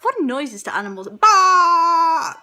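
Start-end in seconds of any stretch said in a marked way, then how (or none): 1.2-2.3 loud high bleat; a loud sheep baa; blaring sheep bleat. Shown as a demonstration.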